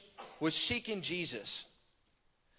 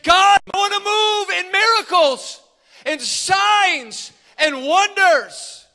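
high, loud cry to God; top-of-lungs shout; 13 semitones higher, 13 dB louder, slower than usual. Retold or imitated. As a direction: shout the plea with all your lungs, stretching the last words. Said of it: "at the top of his lungs, he shouted".

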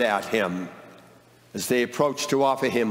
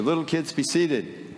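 ordinary talk, nothing marked

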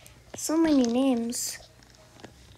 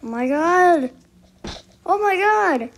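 usual speaking voice

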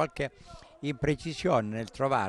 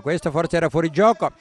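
louder, rising excitement; excited; 5 semitones higher, 9 dB louder than usual. Real, not acted. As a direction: repeat it with mounting energy.

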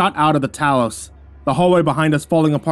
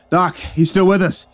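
higher, louder relieved call; joyful relief; 6 semitones higher, 4 dB louder, slower than usual. A narrator's reading. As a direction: greet them with relieved joy. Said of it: "his joy quickly fleeting".

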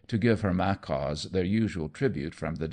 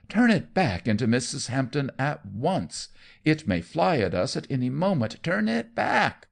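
higher, louder frantic orders; panicked command; 7 semitones higher, 4 dB louder than usual. A narrator's reading. Aunt frantically ordering her nephew, high and loud. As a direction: demand it in rising panic.

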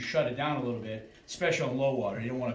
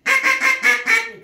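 loud duck call; 13 dB louder than usual. Shown as a demonstration.

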